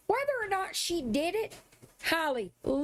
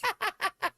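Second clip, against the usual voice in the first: short real laugh; 5 semitones higher than usual. Real, not acted. Laughs at chat comment.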